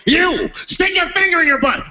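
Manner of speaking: high, crazed commanding voice; high-pitched mock demand; making demands. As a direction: order them about like a comic hostage-taker. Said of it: "he starts making demands".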